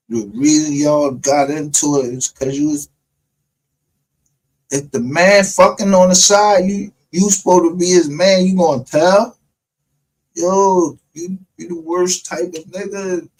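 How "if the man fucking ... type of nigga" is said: louder and higher, worked up; heated rant. Real, not acted. Streamer venting to chat about a snitch, loud and worked up. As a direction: push it out like you can't believe it.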